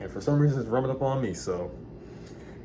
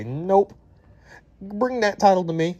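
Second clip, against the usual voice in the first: a higher, louder mock royal decree; Queen Anne; 10 semitones higher, 5 dB louder, slower than usual. Queen Anne snatching the capital, mock royal command. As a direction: play the queen claiming the title, raised. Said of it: "until Queen Anne decided to say".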